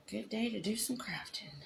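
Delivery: "Good day to do some crafting" echoed in a whisper. Shown as a demonstration. Echoing her own line to viewers as a soft whisper. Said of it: "It's a good day to do some crafting".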